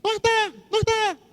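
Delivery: squeaky high parrot chatter. Retold or imitated. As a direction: squawk it like a talking parrot. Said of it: "Y llega la señora". (voz de loro, muy aguda)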